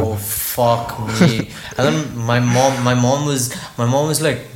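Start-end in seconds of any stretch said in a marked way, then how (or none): 0.0-1.5 very slow, drawn-out groan of doom; retold sinking dread. Son summoned to the bedroom by his mother, drawn-out mock dread. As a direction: stretch it out as if doomed.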